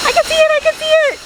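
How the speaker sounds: high, fast, thrilled cry; excited. Real, not acted. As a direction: blurt it out in delight. Spotting the waterfall.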